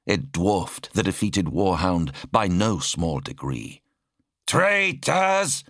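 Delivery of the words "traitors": much higher yelled accusation. Acted. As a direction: yell it at the enemy. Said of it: "one of the attacking Skitari yelled".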